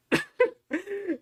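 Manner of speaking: high burst of laughter. Real, not acted. Laughing while telling a friend the story. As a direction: crack up at the memory.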